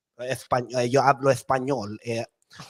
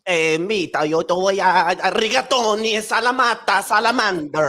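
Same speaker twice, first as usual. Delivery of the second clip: put-on attack Italian accent; 4 semitones higher than usual. [name] does an aggressive fake Italian accent for the audience. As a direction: rant in hammed-up fake Italian. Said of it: "give the audience an attack Italian accent".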